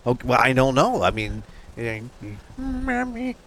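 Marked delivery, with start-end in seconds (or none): 2.6-3.4 high, small mummy voice; talking mummy. Acted. Host imitating a mummy's voice, high and softer. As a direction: squeak it out like a little mummy.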